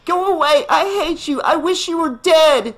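put-on very high child's hateful scream. A narrator's reading. Daughter in canoe to her father, hateful scream. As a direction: scream it with loud, high fury. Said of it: "Tina screamed".

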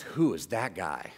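put-on very low, slow, ominous older man; older gentleman. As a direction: drop deep and quiet, measured menace. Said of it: "the older gentleman puts the newspaper down".

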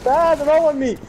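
high, loud panic; panicked. Real, not acted. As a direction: cry out cornered. Panicked under fire.

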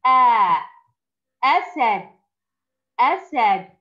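آ called out loud then أسد twice; model reading. Shown as a demonstration. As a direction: sound out آ then read أسد clearly. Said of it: "حركة الفتح". (قراءة نموذجية للأطفال)

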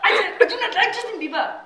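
put-on German-Russian accent, emphatic. Shown as a demonstration. Comic shows off accents while knocking Justin, German-Russian accent. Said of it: "the German Russian accent".

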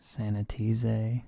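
"sanitize" slowly as sani-tee-zay; mock Spanish ending. Shown as a demonstration. Teacher invents Spanish for students, "sanitize" ending in "zay". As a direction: turn "sanitize" into slow fake Spanish ending in "ay". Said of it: "just add a".

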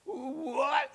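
half-asleep husband. A narrator's reading. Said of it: "half asleep".

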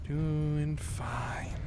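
slow, soft whisper; whispered self-reassurance. Real, not acted. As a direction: whisper it to calm yourself. Nervous self-soothing during careful driving.

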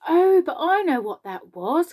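high, loud burst of recognition; excited cry. A narrator's reading. James interrupting the centipede's story, excited cry. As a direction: cry out as it dawns on you. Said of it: "cried James".

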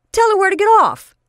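high, loud cheering shout; excited shout. Acted. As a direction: cheer her on loudly. Otis egging her on.

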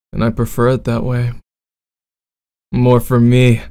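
bad boy roleplay.

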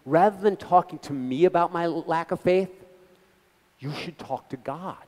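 ordinary delivery

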